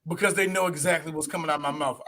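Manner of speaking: ordinary delivery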